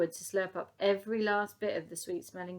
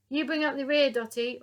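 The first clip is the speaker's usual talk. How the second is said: loud, higher command; ordering; 6 semitones higher, 8 dB louder than usual. Acted. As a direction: give the order firmly. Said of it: "ordered the old soldier".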